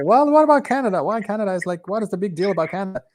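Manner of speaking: mock the indignant hardliners; high, scornful quoted objection; scoffing nationalists. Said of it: "they say".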